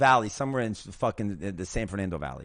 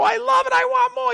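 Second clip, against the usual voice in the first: high, loud acted-out excitement; elated shout; 9 dB louder than usual. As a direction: shout it as if begging eagerly for more. Retold jumping up elated.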